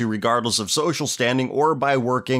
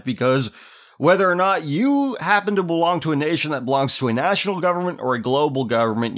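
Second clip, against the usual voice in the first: normal talking voice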